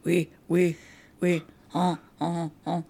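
stiff, mechanical French robot. Pretend. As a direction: say it like a clunky robot. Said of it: "That's my robot voice".

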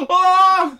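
high gasped oh of shock; mock gasp. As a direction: gasp in fake surprise. Mock shock at class struggle.

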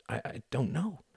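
soft, high, faltering answer; flustered. A narrator's reading. Tom unable to tell Klaus where to go, flustered.

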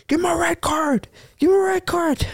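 high mock yell of the other team; imitating their whole team yelling; mock screaming. Retelling the other team yelling at the ref. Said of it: "Their whole team was screaming".

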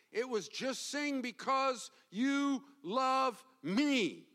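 high, loud impassioned preaching; preaching at full force. Acted. Pastor driving the point home to the congregation, full-force preaching. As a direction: build it to a fervent pulpit climax.

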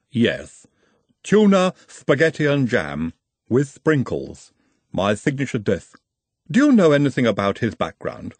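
measured, formal Igor voice with a foreign lilt. Acted. Igor explains the sandwich.

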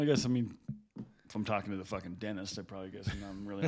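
ordinary talk, nothing marked

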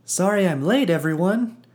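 bright, high, bursting with joy; beaming with joy. Acted. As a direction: bounce in, thrilled to be here. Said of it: "hopped up and down in excitement".

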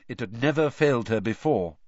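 plain reading voice

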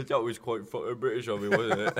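playful put-on British accent; mock-British tag question, half laughing. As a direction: ham up the Britishness. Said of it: "you sound so British right now".

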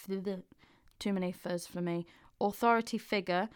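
normal talking voice

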